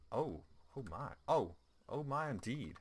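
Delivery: mock posh oh my. Gamer reacting to mission complete, mock posh.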